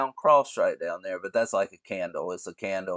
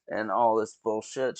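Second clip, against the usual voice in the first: normal talking voice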